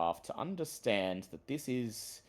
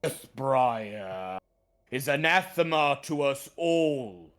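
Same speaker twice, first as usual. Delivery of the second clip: grumbling treant; 4 semitones higher, 7 dB louder than usual. Acted. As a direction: grumble it like an ancient tree. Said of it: "grumbles the treant".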